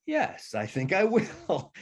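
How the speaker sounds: high, drawn-out pleased yes; delighted acceptance. Retold or imitated. Author retells answering a request for another book, delighted. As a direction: stretch it out with slow, lifted relish.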